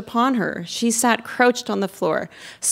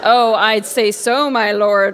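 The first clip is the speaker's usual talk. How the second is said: slow, louder, drawn-out sarcasm; sarcastic; 2 semitones higher, 5 dB louder, slower than usual. A narrator's reading. Ivan answers the evil lord he threatens, sarcastically. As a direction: lay on the sarcasm, stretching the words. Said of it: "replied Ivan sarcastically".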